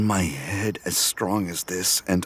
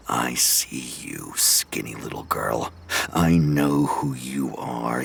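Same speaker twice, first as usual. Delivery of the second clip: menacing killer whisper; 4 semitones lower than usual. Acted. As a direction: hiss it at her like a predator. Killer addresses the werewolf girl.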